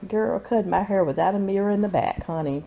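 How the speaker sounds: normal talking voice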